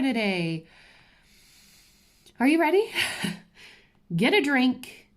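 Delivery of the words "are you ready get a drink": high, loud "are you ready"; bright eager call to viewers; excited tease. Real, not acted. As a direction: hype the audience up before the big news. Teasing the story to viewers.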